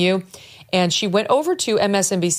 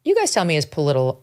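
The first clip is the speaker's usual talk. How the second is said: ordinary talk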